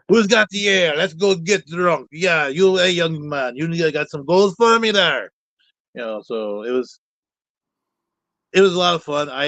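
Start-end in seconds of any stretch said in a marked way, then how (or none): 0.1-5.3 hammed-up dwarf with a bad Scottish accent, higher. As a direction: play a jolly tavern dwarf in a cheesy Scottish accent. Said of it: "or a very bad Scottish accent".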